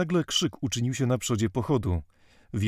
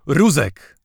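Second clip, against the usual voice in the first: furious roar, much louder and higher; inhuman roar; 4 semitones higher, 10 dB louder than usual. A narrator's reading. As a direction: bellow the order for the rods. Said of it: "ryknął wreszcie nieludzkim głosem".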